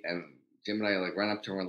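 ordinary delivery

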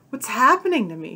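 a scared, rising cry; panicked question; cried out. A narrator's reading. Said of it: "she cried".

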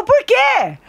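put-on high shouted kid's why; child's high question. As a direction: ask like a worked-up child. Mãe imitando diálogo com filho. (voz aguda de criança)

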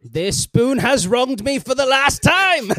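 slow, grand villain voice; mock villain vow. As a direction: intone like a wounded tyrant. Voicing Doom about spoon.

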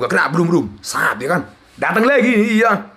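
normal talking voice

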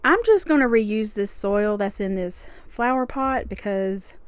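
ordinary talk, nothing marked

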